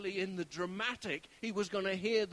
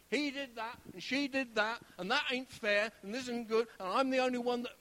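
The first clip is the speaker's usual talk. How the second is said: higher, louder aggrieved moan; mock complaining; 4 semitones higher, 7 dB louder than usual. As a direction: pile up grievances like a sulker. Voicing someone moaning in a cave.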